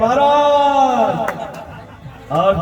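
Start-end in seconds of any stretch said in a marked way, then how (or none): 0.0-1.4 drawn-out high shout of the name; long call. Acted. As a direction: call him back from far down the road. Calling out to Maharaj from behind. (दूर से लंबी ऊँची पुकार)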